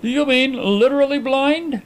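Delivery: high-pitched, incredulous question. A narrator's reading. Visitor questions his friend's blindness, incredulous. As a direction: ask as if you cannot believe it.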